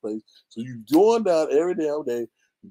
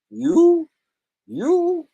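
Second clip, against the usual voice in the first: mocking disbelief; 13 semitones higher, 5 dB louder than usual. Real, not acted.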